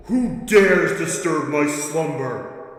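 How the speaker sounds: slow, booming undead king; ancient rasping undead king. Acted. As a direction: speak slowly like an ancient awakened ruler. Awakened creature speaks.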